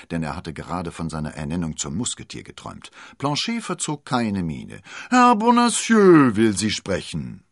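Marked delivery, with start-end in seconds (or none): 5.1-7.4 high, loud nasal servant announcement; drawn-out nasal call, pitched up high. A narrator's reading. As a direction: announce it slowly through the nose. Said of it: "näselte er".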